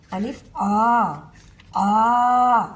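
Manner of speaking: آ stretched to a full alif length, slow and drawn; elongated Quranic آ; long aa. Shown as a demonstration. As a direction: hold the آ long like a recited vowel. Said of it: "لمبا کر کے پڑھیں گے".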